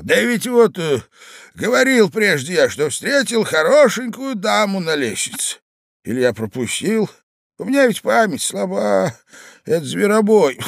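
put-on high, loud old man with coughs; forgetful old husband. A narrator's reading. Old man with a bad memory rambling to wife, coughing.

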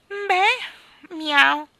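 high, loud bleat into a mew; meowing cat. Acted. Cat answering the king's offer, meowing. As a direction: draw it out like a cat.

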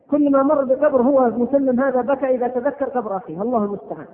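ordinary talk

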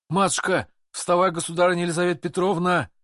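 high urgent shout to wake her. A narrator's reading. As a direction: burst in and yell for the empress. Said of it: "кричал ворвавшийся".

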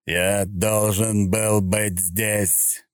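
slow, tolling machine voice; each word like a bell strike; booming golem voice. A narrator's reading. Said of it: "Каждое слово звучало, как удар колокола".

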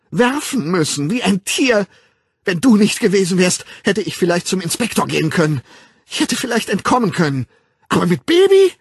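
high, loud, furious tirade; wütendes Schreien. A narrator's reading. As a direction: scream bitter blame at the child. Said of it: "schrie sie". (hoch und hysterisch schimpfen)

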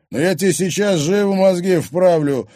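loud, high aggressive threat; menacing threat. Acted. Thug advancing on girl, menacing. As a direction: threaten to set her straight. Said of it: "угрожающе двинулся к ней Оскар".

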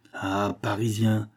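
ordinary delivery

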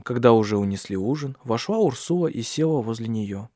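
ordinary delivery

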